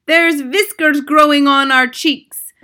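witch mimicking a shouting child; boy's shout. A narrator's reading. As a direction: wail it out in rhyme. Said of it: "Another boy shouts".

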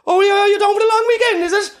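very high, loud squeaky voice; helium voice. Pretend. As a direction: squeak like you inhaled helium. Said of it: "are you high on helium".

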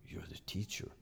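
breathless whispered disbelief; a boy's awed hushed reply; stunned whisper. Retold or imitated. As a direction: whisper it, caught off guard. Stunned child answers teacher.

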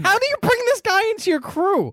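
high-pitched incredulous outburst; mock disbelief. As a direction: question it in squeaky, exaggerated disbelief. Acts out own disbelief at the scene.

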